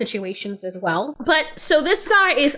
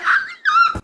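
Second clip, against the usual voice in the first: sudden high cackle; high burst of laughter. Real, not acted. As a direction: let out a squealing laugh. Laughs at the clip.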